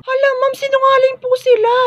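very high tearful acted whine; wailing protest. Accused monitor tells teacher they lie, tearful wail.

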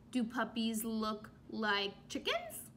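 ordinary delivery

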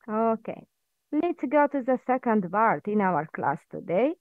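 ordinary talk, nothing marked

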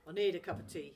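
Dad grunting; grunting father. Acted. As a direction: mutter for tea. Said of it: "he grunted".

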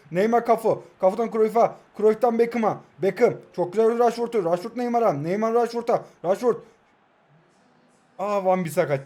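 quick commentator calls of each pass; light play-by-play. Acted. Live play-by-play of own match.

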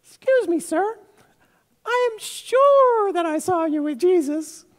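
put-on very high, squeaky child voice; little slave girl. Slave girl questioning Peter by the fire, tiny high voice. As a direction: pipe up like a small girl with a question. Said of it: "a little slave girl".

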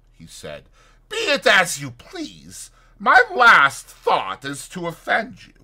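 high, loud, protesting gentleman; placating doctor. A narrator's reading. Doctor answers Fetz's refusal, high and placating. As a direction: protest your good intentions emphatically.

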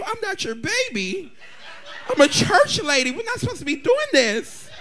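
high, hammed-up protest; mock-prim church lady. Church lady refusing an eager suitor, mock-prim protest.

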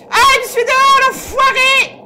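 high relieved yell; triumphant shout. Real, not acted. Escapes the monster outside. (cri de soulagement)

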